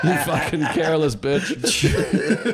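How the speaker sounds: mock angry comeback.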